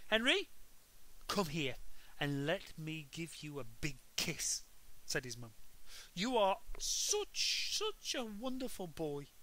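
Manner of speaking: dote on him lavishly; gushing mum. A narrator's reading. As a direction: mum praising Henry with a kiss. Mum gushing over Henry.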